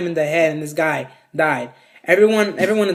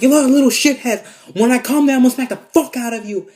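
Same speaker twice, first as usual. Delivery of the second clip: high, raised mock-furious threat; imitated angry yelling; 8 semitones higher, 5 dB louder than usual. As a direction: act out a man threatening kids. Imitating angry man threatening kids.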